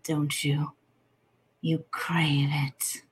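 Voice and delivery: normal talking voice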